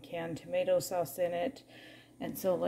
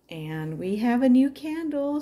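higher and louder, thrilled announcement; excited; 6 semitones higher, 7 dB louder than usual. Real, not acted. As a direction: burst out with the good news. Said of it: "so we're so excited".

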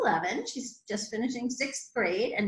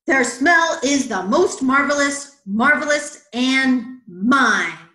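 loud, emphatic frog; possessive bully; 2 semitones higher, 9 dB louder, slower than usual. A narrator's reading. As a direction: hammer each word to stake a claim. Bully frog claims the lilies' smell.

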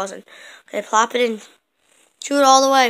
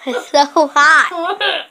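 laughing; 5 semitones higher, 9 dB louder, much slower than usual. Real, not acted. Eating hot candy, laughing.

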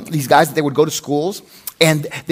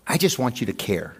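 soft plea; 6 semitones lower, 9 dB quieter than usual. Retold or imitated. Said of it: "I've had my wife tell me this".

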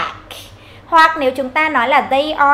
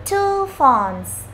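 slow, clear teacher demo; slow model answer; 2 semitones higher, much slower than usual. Shown as a demonstration. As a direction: drill the plural slowly. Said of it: "chúng ta sẽ nói là".

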